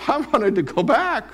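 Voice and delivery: high, pleading look-back; pining whine. Retold or imitated. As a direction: whine for the old life. Himself newly saved, pining.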